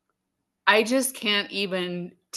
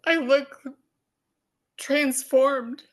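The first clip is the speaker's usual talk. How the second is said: emotional; 5 semitones higher than usual. Real, not acted. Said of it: "I'm emotional".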